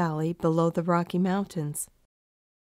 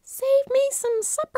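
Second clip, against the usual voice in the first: very high, far-off squeaky goat voice; a tiny high voice tossed off mid-fall; falling goat's cry. Acted. The great aunt falling off a cliff, squeaking back in a high voice.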